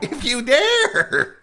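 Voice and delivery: high, slow spooky cackle-voice; laughing spooky shriek; horror host. Acted. Echoes tagline laughing, very high.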